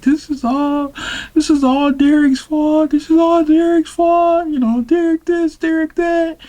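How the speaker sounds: very high tearful wail; hammed-up sobbing blame in falsetto; mock crying rant. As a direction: imitate a weepy date going on about an ex. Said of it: "and she's crying".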